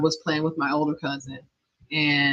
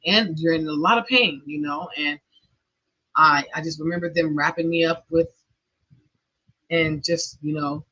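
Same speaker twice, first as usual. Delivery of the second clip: ordinary delivery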